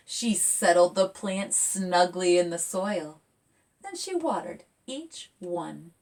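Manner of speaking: lively picture-book read; reading to children. A narrator's reading. Author reading her picture book aloud to kids, lively. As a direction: read it bright and expressive.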